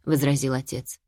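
usual speaking voice

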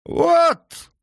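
put-on high, loud slurred drunk outburst. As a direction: blurt it with a drunken tongue. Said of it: "заплетающимся языком проговорил Яго".

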